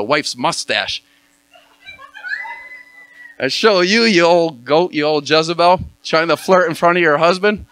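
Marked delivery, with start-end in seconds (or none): 3.4-7.7 hammed-up loud telling-off, voice raised; mock scolding. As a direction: playfully rant at her, louder and higher. Scolding the flirting wife.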